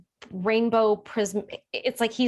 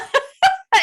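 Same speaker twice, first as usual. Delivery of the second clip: much higher, louder laugh-cry; a tearful burst of laughter; laughing sob; 10 dB louder than usual. Real, not acted. Recalling the moment, laughing while teary.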